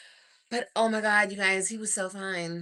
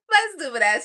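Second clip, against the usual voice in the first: giggling through the words; real laugh, high and loud; laughing at herself; 7 semitones higher, 7 dB louder than usual. Real, not acted. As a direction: laugh it out, embarrassed.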